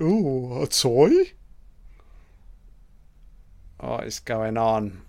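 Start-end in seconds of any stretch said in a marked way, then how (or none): 0.0-1.3 mock-curious monster with a rising lilt; Rawhead. Voicing the monster spotting a toy, playfully curious. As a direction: coo it higher, ending on a questioning rise. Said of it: "Rawhead's like".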